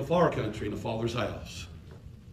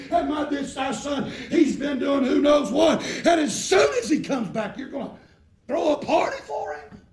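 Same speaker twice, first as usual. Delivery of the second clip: angry; 10 semitones higher, 4 dB louder than usual. Retold or imitated. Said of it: "He said".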